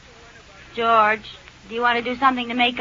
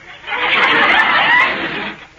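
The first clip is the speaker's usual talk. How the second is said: a high, loud peal of laughter; high burst of laughter; 5 dB louder than usual. Acted. Wife laughs at her distracted husband, a high loud peal. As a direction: laugh out high at his absent-minded yes. Wife amused at his absent reply.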